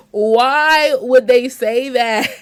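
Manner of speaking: loud, slow mock outrage; loud disbelief. Real, not acted. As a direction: stretch it out slowly in exaggerated shock. Repeats reaction to the quote.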